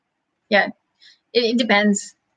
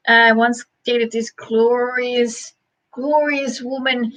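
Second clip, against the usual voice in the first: slow savouring; slower than usual. Real, not acted. Savouring memory of a woman.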